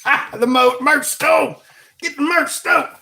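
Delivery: high, silly hawker's cry; mock pleading pitch. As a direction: plug it in a comic squeal. Comic begging to buy merch.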